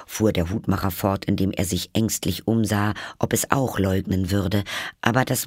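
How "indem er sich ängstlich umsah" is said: plain reading voice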